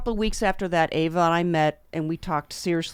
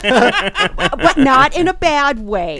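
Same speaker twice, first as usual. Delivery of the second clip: loud, high, drawn-out and laughing; laughing protest; 7 semitones higher, 11 dB louder, much slower than usual. Real, not acted. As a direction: laugh while hurrying to take it back. Hurried clarification amid group laughter.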